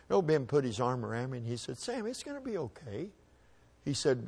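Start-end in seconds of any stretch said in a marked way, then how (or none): 1.8-3.1 soft, high comforting voice; hushed reassurance from a big brother; gentle comfort. Retold or imitated. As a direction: soothe a crying kid, softly. Brother comforting crying boy.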